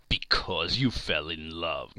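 low, slow mocking growl. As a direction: sneer it back at him. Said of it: "growled Scrooge".

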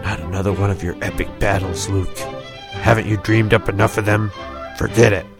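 a bored friend brushing off "Forget it."; disappointed. A narrator's reading. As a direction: dismiss it, let down. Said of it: "Deke looked disappointed".

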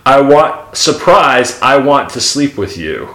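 loud, high hammed-up TV guest; shrill overlapping reality fight. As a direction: belt out the shocking reveal. Acts trashy talk show reveal.

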